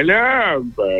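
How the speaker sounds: high, drawn-out comic catchphrase yell; mock shout. Performing the catchphrase his friend asked for, mock shout. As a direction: cry out LÁMPARA high, at modest volume. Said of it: "Grita lámpara".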